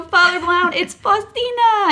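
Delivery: high, loud, drawn-out call; excited calling out. Retold or imitated. Mother hails the priest down the hallway, excited calling out.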